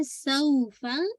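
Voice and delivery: सौफा with the औ held about two counts; two-count glide. Shown as a demonstration. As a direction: slowly glide through सौ, then short फा. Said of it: "टू almost इसको पढ़ना है". (सौफा में औ को खींचकर, फा छोटा)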